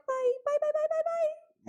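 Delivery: very high sing-song imitation of phone goodbyes; going up on the end. Mimicking Brits getting off the phone, high lilting byes. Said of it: "up on the end".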